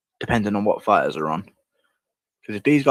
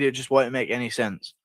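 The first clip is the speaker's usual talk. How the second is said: ordinary talk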